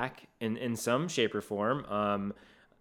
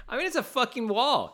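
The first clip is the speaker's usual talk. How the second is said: exasperated; 12 semitones higher, 3 dB louder than usual. Real, not acted. Exasperated at the steep climb.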